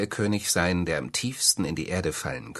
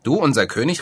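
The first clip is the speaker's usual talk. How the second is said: angrier birds; 7 semitones higher, 6 dB louder than usual. A narrator's reading. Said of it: "riefen die Vögel noch zorniger".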